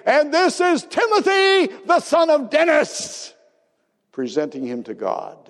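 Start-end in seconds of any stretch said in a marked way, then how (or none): 0.1-3.3 high, loud proud announcement; proud father's call. Retold or imitated. Father presenting newborn, calling out proudly. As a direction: lift the baby up and call it out. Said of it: "it would cry out like I would say".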